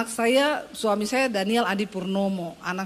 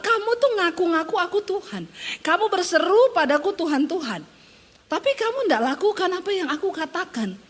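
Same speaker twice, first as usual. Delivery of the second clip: high, exasperated reproach; God complaining; 8 semitones higher than usual. Retold or imitated. God rebuking Christians who call him Lord, high and exasperated. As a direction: let God sound fed up with false followers. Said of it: "Tuhan bilang".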